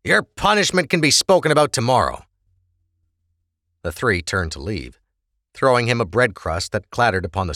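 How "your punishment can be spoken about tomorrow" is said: lightly voiced stern officer, raised. Officer scolding the prisoner, lightly stern. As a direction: lay down the law to him.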